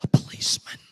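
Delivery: put-on little boy's secretive whisper. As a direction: whisper it into the phone. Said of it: "came back on the phone whispering".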